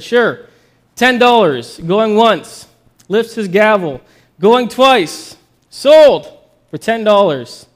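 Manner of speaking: loud, rising auctioneer's call; auctioneer calling bids. Acted. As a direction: build to a loud sold.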